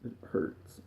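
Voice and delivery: barely a breath, voice gone in grief; whispered. Real, not acted. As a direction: let the words "It hurts" fall out as a toneless whisper. Grieving grandfather, breaking down.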